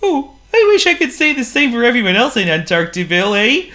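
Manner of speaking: very high, odd penguin voice, a little glum. Acted. Penguin tells the snowman the town is gloomy, in a high penguin voice.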